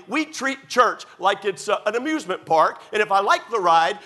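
full-force sermon delivery; preaching. Acted. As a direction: preach it out to the congregation.

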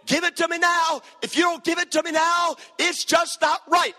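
high, insistent mock whining; childish demanding. Preacher mimicking a now-crazed society, high childish demanding. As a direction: voice it like an impatient child. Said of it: "And they voice it".

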